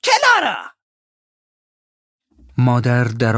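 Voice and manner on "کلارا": sharp high shrieked name, loud; frightening warning. Acted. Miss Murdstone warns the mother with a loud, high cry of her name. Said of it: "با صدای ترسناکش هشدار داد".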